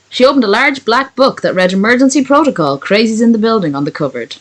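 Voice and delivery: lively read-back without pauses. Real, not acted. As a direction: read the finished line straight through. Reads back the finished sentence.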